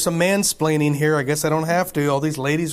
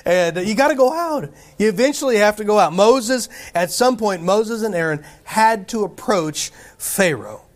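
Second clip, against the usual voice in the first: preaching; 4 semitones higher than usual. Acted. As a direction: urge them out the door. Preaching climax.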